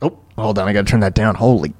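ordinary talk, nothing marked

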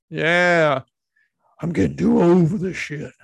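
a half-hearted try at his famous line; Danny Glover impression. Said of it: "I can't even do his voice. It's too raspy".

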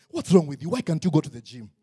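loud mock scolding; scolding father. Father scolding his weird son, mock scold. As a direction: bark it like a disappointed dad.

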